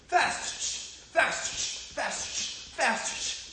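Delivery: short, high, sharp cues; quick punchy bursts of "Fast". Shown as a demonstration. Coach cueing kids' quickest punches, fast. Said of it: "when I say fast, you're gonna do your quickest punch".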